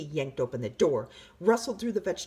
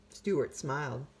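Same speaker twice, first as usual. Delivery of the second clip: ordinary delivery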